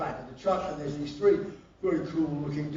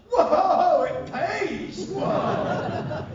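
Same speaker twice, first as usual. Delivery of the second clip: high, loud, laughing whoops of fake wonder; mock amazement; 13 semitones higher, 6 dB louder, much slower than usual. Singer told the gig pays, hammed-up laughing excitement. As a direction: ham up being thrilled at a tiny wage.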